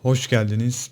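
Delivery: ordinary talk, nothing marked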